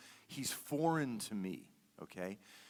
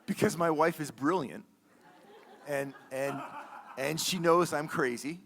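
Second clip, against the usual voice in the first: louder, laughing through the words; 5 semitones higher, 10 dB louder than usual. Real, not acted. Telling the congregation about his wife, laughing aloud.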